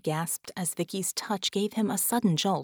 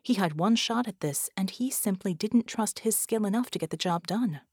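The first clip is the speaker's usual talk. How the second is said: ordinary delivery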